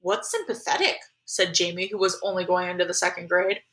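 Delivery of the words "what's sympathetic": put-on high, small, puzzled child voice; puzzled little brother. Second grader asks mom about a word, puzzled. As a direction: ask softly like a little kid.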